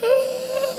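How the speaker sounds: high mock wail; long, thin toddler cry; crying child. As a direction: imitate a small girl bawling. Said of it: "ela começou a chorar".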